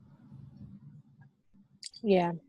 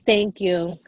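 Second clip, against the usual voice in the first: normal talking voice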